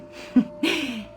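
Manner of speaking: small amused laugh; short, playful laugh; light chuckle. Acted. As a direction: chuckle to yourself briefly. Amused at own admission.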